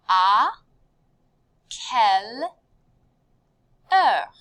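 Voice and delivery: "À", "quelle", "heure" with long pauses between; loud, separated teaching delivery of "À quelle heure"; word by word. Shown as a demonstration. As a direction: model each word separately and firmly. Teacher models phrase word by word.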